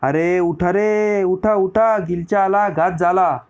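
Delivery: high, drawn-out cry of alarm; alarmed shouting. A narrator's reading. Sleeping men wake the camp to an enemy attack, alarmed shouting. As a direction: call out slowly and high to rouse a sleeping camp. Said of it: "हातात भाले तलवारी घेऊन ओरडू लागले".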